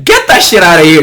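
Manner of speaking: high, loud dismissive hell no; mock-outraged refusal; loud scoff. Real, not acted. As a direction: brush it off loudly. Rejecting average gameplay.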